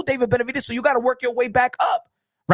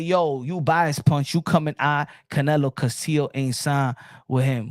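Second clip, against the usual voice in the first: a hint of a lower, slow heckler voice; lightly voiced commenter; 7 semitones lower than usual. Vlogger reading out a viewer's accusation in a lower mocking voice. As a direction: toss off a viewer's jab, lower and unhurried. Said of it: "He said".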